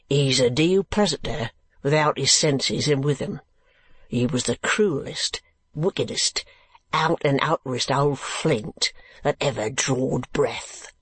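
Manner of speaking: slow, rustic working man; keeper, disapproving. Acted. Keeper telling Kate of madman's past, grimly disapproving. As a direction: weigh each insult slowly, in a rough keeper's voice.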